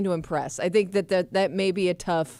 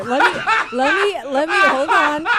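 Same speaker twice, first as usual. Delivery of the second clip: laughing; 9 semitones higher, 7 dB louder than usual. Real, not acted. Flustered laughing before answering.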